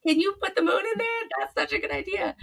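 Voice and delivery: high, loud delighted gushing; thrilled, pitch jumping up; excited squeal. Retold or imitated. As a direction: burst with glee over the idea. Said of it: "And I'm like".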